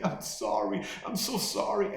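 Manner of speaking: mock tearful apology, jumping high on the repeat; imitated bawling. Counter girl apologizing after hearing mom died, mock bawling. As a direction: imitate a sobbing apology, pitch climbing. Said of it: "she's bawling and she's saying".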